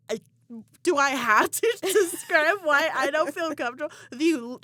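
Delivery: can't believe she has to explain; exasperated. Retold or imitated. Explaining her boundary to her ex, loud exasperation.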